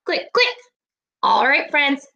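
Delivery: ordinary talk, nothing marked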